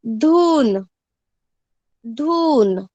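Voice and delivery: धून said loudly with the u held; clear model reading. Shown as a demonstration. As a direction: repeat धून loudly for the class. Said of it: "उच्चारण अच्छे से देखो". (धून ज़ोर से, साफ़ बोलना)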